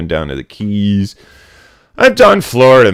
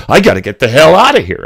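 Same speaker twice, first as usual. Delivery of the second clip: urgent exclamation; 5 semitones higher, 5 dB louder, faster than usual. Retold or imitated. Quotes own urge to flee Florida.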